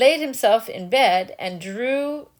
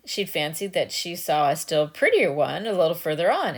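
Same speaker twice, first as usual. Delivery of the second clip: usual speaking voice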